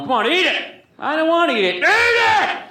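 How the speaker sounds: high, loud mock yelling between dad and kid; dad forcing him to eat leftovers, shouted. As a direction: shout it like an angry father at breakfast. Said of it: "Dad be going".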